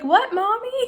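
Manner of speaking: put-on high, laughing child's question; a small kid asking, puzzled; little girl voice. Voicing her little girls.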